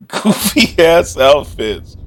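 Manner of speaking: normal talking voice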